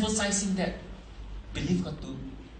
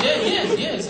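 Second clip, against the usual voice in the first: laughing yes; 11 semitones higher, 7 dB louder, slower than usual. Real, not acted. Laughing after customer joke.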